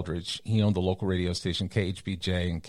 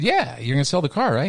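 eager; 6 semitones higher, 5 dB louder than usual. Retold or imitated. Eager kid confirming.

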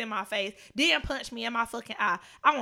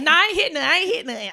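louder, higher, protesting; loud denial; 7 semitones higher, 10 dB louder, much faster than usual. Real, not acted. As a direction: shoot down the accusation right away. Denies hitting cars, incredulous.

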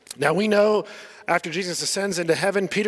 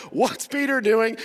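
usual speaking voice

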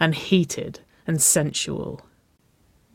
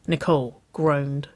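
ordinary delivery

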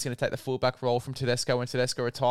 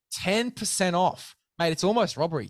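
ironic hype; 6 semitones higher than usual. Acted. Mock hype over small discount.

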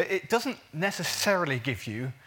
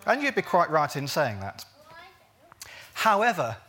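ordinary delivery

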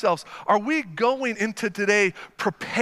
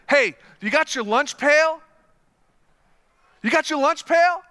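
fired-up teammate; 5 semitones higher, 3 dB louder than usual. Retold or imitated. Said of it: "He's going around to people".

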